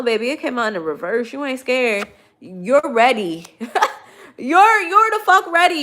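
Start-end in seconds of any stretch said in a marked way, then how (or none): 4.4-5.8 higher, louder hyped-up shout; hype shout. Real, not acted. Reader hypes up the viewer with a loud, excited shout. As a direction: fire them up, laughing into it. Hyping viewer up after laugh.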